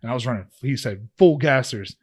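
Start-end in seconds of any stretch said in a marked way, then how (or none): 1.2-1.9 coach yelling. Retold or imitated. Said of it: "coach barking punishment".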